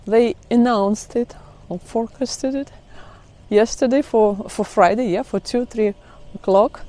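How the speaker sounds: normal talking voice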